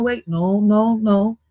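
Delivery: ordinary talk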